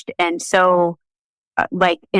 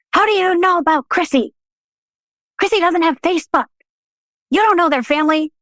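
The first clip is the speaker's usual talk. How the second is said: higher, mock upset yell; retold yelling; 12 semitones higher than usual. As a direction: play his shocked anger, pitched up. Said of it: "starts yelling at me".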